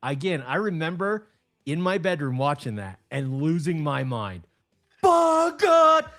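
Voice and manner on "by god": loud, high, hammed-up By God; mock announcer overjoyed; mock commentator exclamation. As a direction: holler it like a shocked ringside announcer. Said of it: "that's us making fun".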